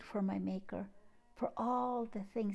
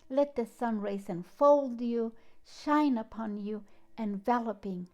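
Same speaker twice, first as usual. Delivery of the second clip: louder, declaimed poetry reading with long pauses; dramatic reading of her own poem, louder throughout; poem recital; 5 dB louder than usual. Acted. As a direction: proclaim each line like a sunrise call to the heart. Reads her poem aloud.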